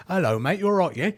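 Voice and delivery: exaggerated English accent, high and loud; loud, high-pitched mock-British greeting. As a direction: overdo a cheery English accent. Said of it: "did it with an English accent".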